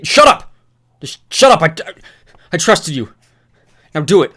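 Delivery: very loud, high shrieking; frantic rage scream. Acted. Newt screaming at Thomas to shoot, frantic rage. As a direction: scream him into silence.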